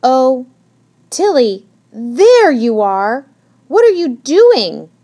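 loud, high, drawn-out exclaiming; exasperated old hedgehog. A narrator's reading. Missus Higgins finds Tilly on the stairs, loudly exasperated. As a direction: call out in exasperation, stretching words.

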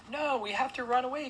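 very high, loud panicked shout; panicked cry. A narrator's reading. Frightened guest arguing against hiding, panicked cry. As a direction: cry out frantically, voice way up. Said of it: "cried a guest".

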